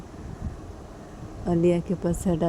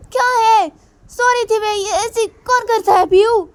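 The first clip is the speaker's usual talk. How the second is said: much higher, much louder angry shouting; furious yell; 9 dB louder than usual. Acted. Mother woken by music shouts at Piyu, furious. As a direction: blast him for waking you.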